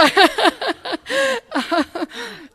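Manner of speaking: long, high, loud burst of laughter; real belly laugh, high and loud; hearty laugh. Real, not acted. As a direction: laugh out loud at your own joke. Laughing at own lead-foot joke.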